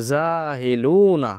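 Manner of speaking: জাহিলুনা said very slowly, each syllable clear; teacher stretching জাহিলুনা slowly; slow demo pronunciation. Shown as a demonstration. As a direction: drag জাহিলুনা out for students to catch. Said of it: "খুব খেয়াল করবেন".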